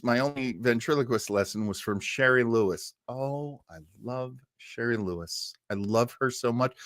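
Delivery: ordinary talk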